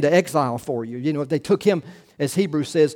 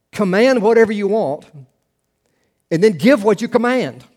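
emphatic quote; 5 semitones higher, 5 dB louder than usual. Retold or imitated. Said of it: "Augustine said".

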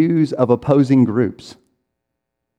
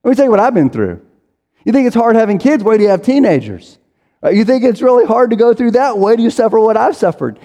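higher, louder mock one-upmanship; a higher pitched, louder boastful mock voice; one-upping brag; 8 semitones higher, 4 dB louder, faster than usual. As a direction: outdo their story, hammed-up. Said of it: "maybe you sometimes say".

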